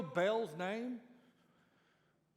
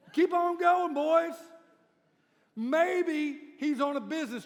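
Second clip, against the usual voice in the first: much higher, loud, slow mocking taunts; old school trash talk; 7 semitones higher, 10 dB louder than usual. Elijah mocking Baal's prophets, loud trash talk. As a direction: rub it in with glee. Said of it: "does a little old school trash talk".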